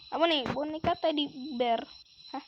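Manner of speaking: ordinary talk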